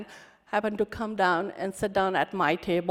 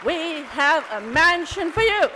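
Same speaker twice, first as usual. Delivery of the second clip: higher and loud, ringing out; grand welcome; 6 semitones higher, 11 dB louder than usual. Retold or imitated. Repeating the welcome to the women, loud and high. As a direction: proclaim it warmly to the room.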